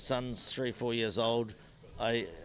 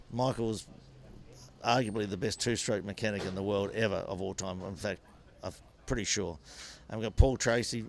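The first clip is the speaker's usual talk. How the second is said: ordinary talk, nothing marked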